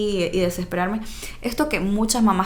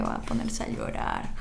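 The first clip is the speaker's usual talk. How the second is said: voiceless worried whisper; whispered fear; 7 dB quieter than usual. Retold or imitated. Mother fearing her son will cry, whispered. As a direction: whisper the dread to yourself.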